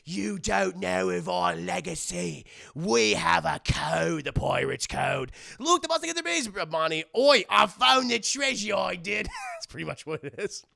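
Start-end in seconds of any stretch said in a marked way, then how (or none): 0.0-9.3 slow, loud pirate swagger; hammy pirate talk; pirate rat impression. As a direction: play a boastful pirate rat. Mimicking the pirate rats.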